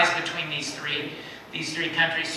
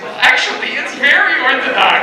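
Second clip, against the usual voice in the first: higher and louder, playful correction; wry comeback; 8 semitones higher, 10 dB louder than usual. Retold or imitated. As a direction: land the pun with a cheeky lift. Punchline retort to minister.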